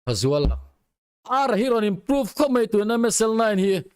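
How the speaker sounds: ordinary talk, nothing marked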